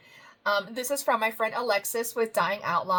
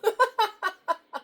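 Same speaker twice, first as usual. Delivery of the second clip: high laugh; 10 semitones higher, 3 dB louder than usual. Real, not acted. Laughing at the dough-punching bear.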